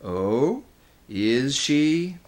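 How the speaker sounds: lightly menacing, drawn-out question; a hint of a dreadful creature challenging someone; gray, terrible voice. A narrator's reading. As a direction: ask it with understated menace. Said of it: "That gray and terrible voice".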